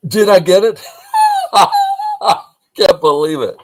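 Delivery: high, loud squeal of disbelief with a laugh; overjoyed, almost crying with laughter; delighted laughing. Real, not acted. Teacher thrilled at guessing the viewer's name, high loud laughing squeal.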